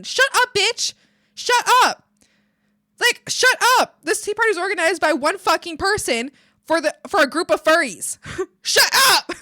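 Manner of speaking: high, loud outburst of indignation; exasperated shouting. Real, not acted. Ranting at the guest who insulted the party, exasperated shout. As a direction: yell it at them, half laughing.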